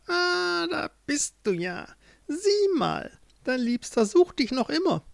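high witch voice, falsely sweet; taunting witch. Acted. (höhnische, hohe Hexenstimme)

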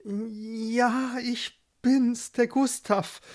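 high, shy stammer; stammering suitor. A narrator's reading. Shy Gustav answers his beloved Barbara, stammering. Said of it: "stammelte der".

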